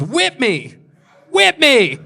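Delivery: rising to a high, loud yell; hammed-up excited command; eager demand. Acted demand to be whipped.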